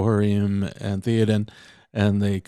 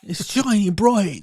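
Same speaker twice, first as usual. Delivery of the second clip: put-on Cockney accent; 9 semitones higher than usual. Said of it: "I just do the Cockney".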